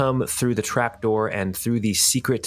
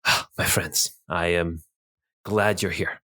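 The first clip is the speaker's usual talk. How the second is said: Teagish greeting; 3 semitones lower than usual. Acted.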